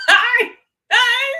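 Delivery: high laughing squeal; words breaking up in laughter; laughing hard. Real, not acted. Streamer cracking up at chat, laughing.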